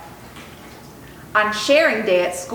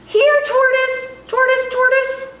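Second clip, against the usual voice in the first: calling shout; 3 dB louder than usual. A narrator's reading. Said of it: "we shout a billion times".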